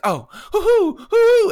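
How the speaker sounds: high, loud mock loon calls hoo-hoo; imitated loon hoots. Retelling the movie mom calling to the loons, loud high hoots. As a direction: hoot like a loon across the lake. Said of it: "she's just like".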